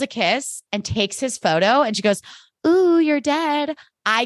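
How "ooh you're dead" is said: teasing taunt. Retold or imitated. Retelling Jenny's flirty taunt.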